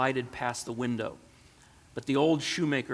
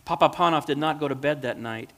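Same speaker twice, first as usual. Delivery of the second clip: usual speaking voice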